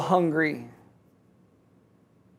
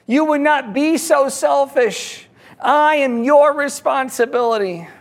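higher-pitched acted protest, slowing to a plea; pleading yell back; 5 semitones higher than usual. Man at the bottom begs not to be dropped, pleading call. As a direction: plead upward, drawing out the last words. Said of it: "guy at the bottom yells back".

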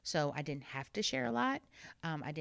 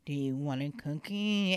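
reluctant offer; 3 semitones lower than usual. Retold or imitated. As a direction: offer it while hoping for a no. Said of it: "hope and pray inside that you say no".

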